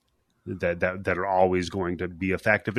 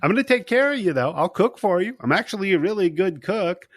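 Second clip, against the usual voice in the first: higher, chirpy imitation of a captor; mock reassurance; 9 semitones higher, 3 dB louder, faster than usual. Captor offering to cook for his captive, mock-reassuring. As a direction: play the captor's helpful offer as a joke, pitched up.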